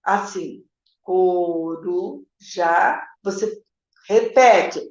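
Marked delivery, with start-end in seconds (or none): 1.0-2.2 slow, low "coruja" in pieces, "co-ru... ja"; "coruja" low and stretched, gap before "ja"; syllable-by-syllable reading. Retold or imitated. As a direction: draw out "coruja" as a child decoding each syllable. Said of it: "A hora que ela leu assim".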